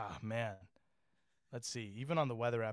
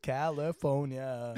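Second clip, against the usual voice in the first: slow mock Arnold voice; drawn-out Arnold impression; Arnold accent; much slower than usual. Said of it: "doing an Arnold impression".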